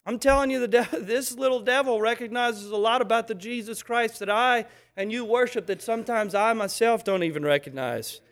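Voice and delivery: ordinary delivery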